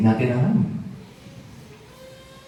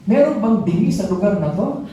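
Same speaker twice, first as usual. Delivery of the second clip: higher, pointed rhetorical jab; challenging question; 8 semitones higher than usual. Retold or imitated. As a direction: ask the crowd with sharp sarcasm. Ate Bel challenges the crowd.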